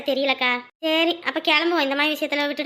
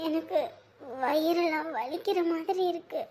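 in pain; 7 dB quieter, slower than usual. Acted. Girl in pain, weak voice.

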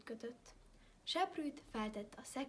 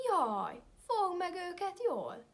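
loud, high panicked wolf; frightened wolf cry; 7 semitones higher, 8 dB louder than usual. A narrator's reading. Wolf begs the widow to hold her children back. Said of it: "kiáltotta a farkas".

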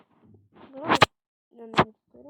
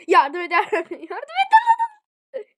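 loud, higher desperate yell; screamed plea for help; 8 semitones higher, 14 dB louder than usual. Real, not acted. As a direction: scream for rescue as you go down. Escalating calls for help, dying.

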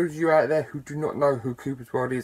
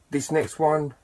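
ordinary delivery

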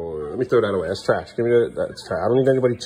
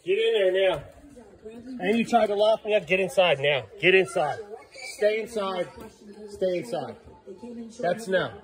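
higher, firm orders to come in; firm command; 8 semitones higher than usual. Real, not acted. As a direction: order the dog straight inside. Ordering dog inside.

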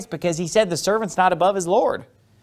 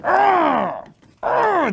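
teeth-gritting growl; 14 semitones higher than usual. Shown as a demonstration. Preacher showing the congregation an enraged teeth-grit, growling. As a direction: growl like a man grinding his teeth in rage. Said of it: "gritting their teeth at you. Like".

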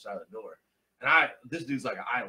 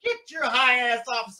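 mock heckle; 11 semitones higher, 8 dB louder, slower than usual. Audience heckling him.

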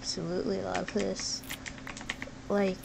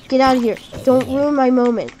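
louder, fed-up shout; loud shooing; 2 semitones higher, 9 dB louder than usual. Real, not acted. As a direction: yell it away from your moment. Shooing something off.

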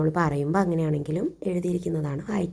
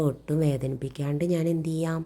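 plain reading voice

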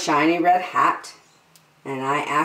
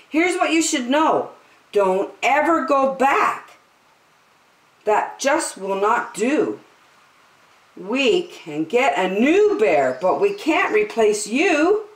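put-on child scolding; 6 semitones higher than usual.

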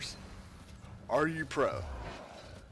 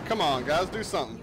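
usual speaking voice

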